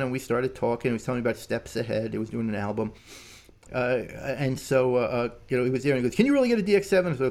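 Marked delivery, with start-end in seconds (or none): 6.1-7.2 high, rapid incredulous question; hurried, pitched-up eager asking; eager disbelief. Retold or imitated. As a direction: imitate a friend who can hardly believe it. Acting out friend's eager question.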